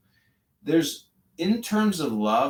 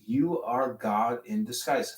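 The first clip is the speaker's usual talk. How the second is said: ordinary delivery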